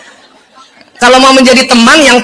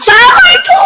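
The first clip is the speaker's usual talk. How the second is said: high laughing outcry; high laughing call; laughing shout. Real, not acted. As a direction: blurt it out through a big laugh. Laughing outburst.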